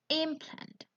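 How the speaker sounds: "implant" with a high-pitched stress on IM; stress on first syllable. Shown as a demonstration. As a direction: say "implant" as a noun, IMplant. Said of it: "While for noun".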